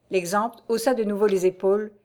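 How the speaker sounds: ordinary delivery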